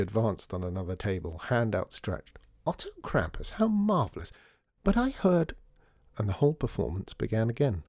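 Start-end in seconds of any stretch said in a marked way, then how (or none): 2.7-5.6 very high, delighted greeting; high-pitched thrilled socialite; gushing society lady. A narrator's reading. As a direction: gush like a lady at a tea salon. Another society lady gushes at Otto.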